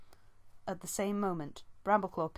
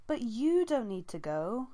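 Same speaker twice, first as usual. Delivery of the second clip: higher, slower pleading wail; wailing plea; 5 semitones higher, 4 dB louder than usual. A narrator's reading. Leafpaw begging her sister to stay, wailing. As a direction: beg her not to go. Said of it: "Leafpaw wailed".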